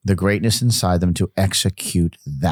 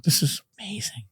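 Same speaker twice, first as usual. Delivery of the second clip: whisper; 9 semitones higher, 6 dB quieter than usual. Retold or imitated. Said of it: "I'm like".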